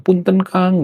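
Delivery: high, polite Sundanese mock complaint; imitasi Sunda lembut. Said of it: "Ngomongnya lembut banget". (menirukan orang Sunda menegur dengan lembut)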